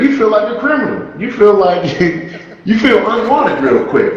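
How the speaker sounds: usual speaking voice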